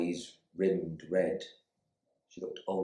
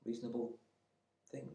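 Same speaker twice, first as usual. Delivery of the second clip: usual speaking voice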